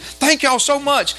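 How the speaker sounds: high, slow, heartfelt imitation; overjoyed thanks. Man thanking those who blessed him, overjoyed imitation. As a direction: stretch out his thanks, pitch way up.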